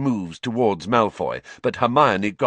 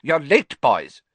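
reproving; 7 semitones higher, 4 dB louder, slower than usual. A narrator's reading. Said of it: "said Professor Flitwick reprovingly".

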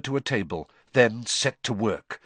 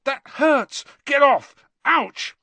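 high, loud yelps of pain; pained protest; 14 semitones higher, 6 dB louder, slower than usual. Acted. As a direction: push her off, hurting. Harry being poked.

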